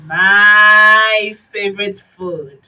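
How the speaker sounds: big drawn-out creature voice; hungry monster. Acted. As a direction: boom it out like a hungry beast. Gruffalo's first hungry line.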